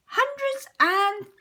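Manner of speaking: gleeful high exclamation; excited cry. A narrator's reading. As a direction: squeal it like a thrilled little girl. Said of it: "cries Peppa".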